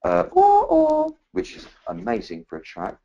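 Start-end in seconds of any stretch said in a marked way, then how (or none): 0.2-1.2 squeaky, very high whoop of a parrot. Retold or imitated. Telling a friend about the parrot, shrill high whoop. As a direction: mimic the parrot's whoop way up top. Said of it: "other amazing".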